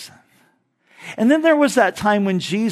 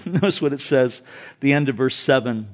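ordinary talk, nothing marked

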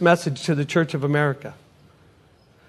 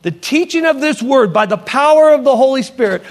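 preaching at full force; 5 semitones higher than usual. Acted. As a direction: drive the message home with fervor. Preaching climax.

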